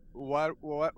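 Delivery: normal talking voice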